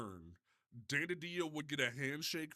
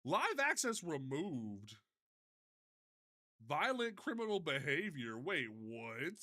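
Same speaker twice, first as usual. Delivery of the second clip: ordinary talk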